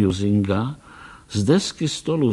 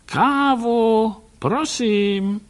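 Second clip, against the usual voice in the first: high, louder call of Kávu so it carries; calling loudly; 12 semitones higher, much slower than usual. Acted. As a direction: call Kávu past the door. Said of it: "Hlasitě pak zavolal". (hlasitě zavolá Kávu přes dveře)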